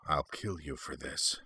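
very soft, hushed menace; whispered threat. A narrator's reading. Sith Lord held at bladepoint, whispering a threat. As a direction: threaten under your breath. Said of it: "Venger whispered".

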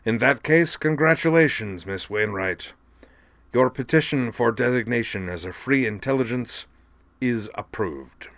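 official congratulation, slowing at the end; formal judge voice. A narrator's reading. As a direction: pronounce approval like a court official. Chairman congratulates and approves.

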